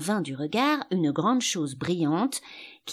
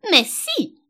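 high, loud contradiction; emphatic retort; 10 semitones higher, 8 dB louder than usual. A narrator's reading. The sheep contradicts Alice, loud and emphatic. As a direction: fire back as the bossy sheep.

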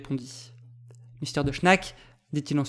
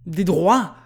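louder, incredulous echoed question; indignant exclamation; 3 semitones higher, 5 dB louder than usual. A narrator's reading. Second interrupter cuts in on the commodore, exclaiming. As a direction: throw the words back as an outcry. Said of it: "s'écria en ce moment un deuxième interrupteur".

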